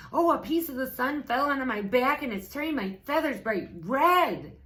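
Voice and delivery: high, loud and fast goose panic; silly goose blurting her alarm to Piglet; fast frantic goose. A narrator's reading. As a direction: rush it out breathless like a scared goose. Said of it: "said the goose".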